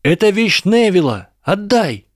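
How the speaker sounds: high, indignant shout; shouted demand. A narrator's reading. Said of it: "крикнул Эрни". (возмущённый крик)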